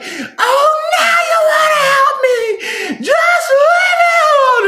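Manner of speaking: put-on high, loud yelling teenage daughter; angry teen yell. Mother's sixteen-year-old daughter screaming at her, high and loud. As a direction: shriek it like a furious teen. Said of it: "And she's like".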